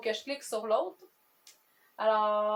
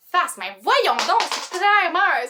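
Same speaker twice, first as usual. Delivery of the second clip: loud, drawn-out mock outrage; exasperated; 7 semitones higher, 9 dB louder, much slower than usual. Real, not acted. The coin falls on face again, loud exasperation. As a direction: protest the coin toss, slow and loud.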